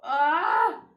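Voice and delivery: loud, high startled cry; startled scream. Real, not acted. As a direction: let out a sudden high yelp. Sudden mishap while painting.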